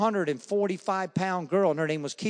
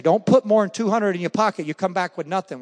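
wife's loud warning; 4 semitones higher, 7 dB louder than usual. Retold or imitated.

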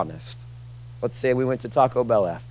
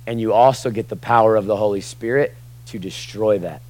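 ordinary talk

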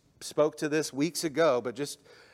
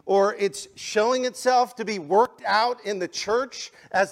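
loud, rising preacher's build to the cross; preaching at full force; 7 semitones higher, 8 dB louder than usual. Acted. Pastor to congregation, preaching at full force. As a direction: drive it home like a revival preacher.

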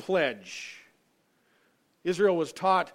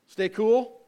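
called-out signal; 5 semitones higher, 8 dB louder than usual. Shown as a demonstration. Preacher calls out the bathroom-break signal to the congregation, loud and bright. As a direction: Stay cool called out high and loud, rising on cool. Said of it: "I'm gonna give a signal".

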